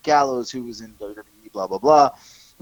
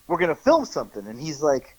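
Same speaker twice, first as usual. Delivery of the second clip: ordinary delivery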